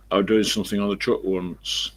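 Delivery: ordinary talk, nothing marked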